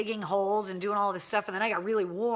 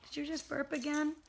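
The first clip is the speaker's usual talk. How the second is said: high, sing-song coaxing of a pet; 8 semitones higher, 7 dB quieter than usual. Real, not acted. Owner calling her pug to come get the monkey toy, high coaxing baby talk. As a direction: coax the sleepy pug over with a toy.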